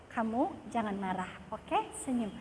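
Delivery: soft, low talk to your reflection; mirror self-talk. Shown as a demonstration. Woman coaches herself in the mirror, quiet and low. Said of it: "Ngaca kalau perlu".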